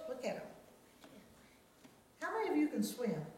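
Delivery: usual speaking voice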